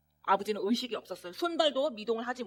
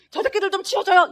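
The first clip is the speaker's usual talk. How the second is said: loud, high desperate yell; shouting for help; 10 semitones higher, 10 dB louder than usual. Retold or imitated. Father in the ICU yelling at the nurses, loud desperate shout. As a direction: yell at the staff to clear them away. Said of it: "이렇게 외치는데".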